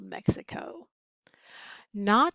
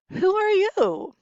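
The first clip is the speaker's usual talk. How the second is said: high loud challenge; 10 semitones higher, 7 dB louder than usual. A narrator's reading. Bandit challenges Skippy.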